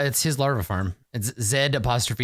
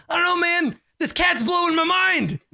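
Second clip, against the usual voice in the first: awestruck shout. Acted. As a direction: marvel at the cat like it's magic. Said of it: "Rash is like".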